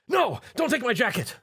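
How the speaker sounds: high panicked cry; frightened young man clutching his jacket; panicked scream. Acted. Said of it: "Tom screamed".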